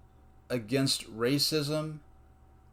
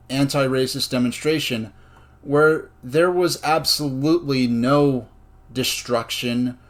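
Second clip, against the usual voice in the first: impassioned, louder; 6 dB louder than usual. Real, not acted. Speech builds to impassioned climax.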